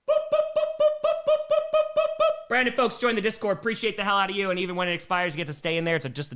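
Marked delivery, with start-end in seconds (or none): 0.1-2.5 high boop noises. Acted. Imitated sound effect.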